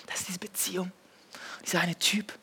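whispered aside, the hidden problem; confessional whisper. Acted. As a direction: breathe it like a secret. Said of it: "unvoiced whisper".

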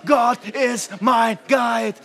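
preacher roaring the promise over music; cool voice. Shown as a demonstration. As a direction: proclaim it big and bold. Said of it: "mit so einer tiefen Stimme, dass es einfach cooler klingt".

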